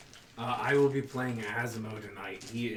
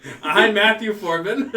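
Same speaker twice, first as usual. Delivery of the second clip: laughing; 7 semitones higher, 13 dB louder than usual. Real, not acted. Laughing while introducing self.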